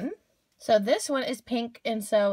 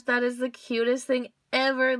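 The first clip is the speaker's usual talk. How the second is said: normal talking voice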